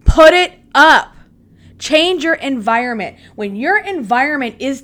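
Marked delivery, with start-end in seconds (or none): 0.0-1.2 slow and loud, laying down the law; firm loud order. Real, not acted. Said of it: "I'm talking to myself right now".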